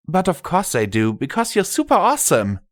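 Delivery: put-on high, quick girlish voice; young daughter voice. Scotch Tape to her father, high, quick young daughter voice. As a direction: chirp it high and fast. Daughter replies happily in story.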